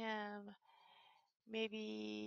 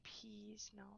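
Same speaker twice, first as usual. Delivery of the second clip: soft whisper listing food; 12 dB quieter, much slower than usual. Real, not acted. Player checking the Sim fridge, soft whisper. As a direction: whisper through the fridge items.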